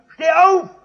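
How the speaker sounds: put-on sudden loud high bark at the child; shouted order. Drunk father yelling at his five-year-old son, loud high bark. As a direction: yell it down at the little boy. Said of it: "dann schreit er seinen kleinen Fünfjährigen an".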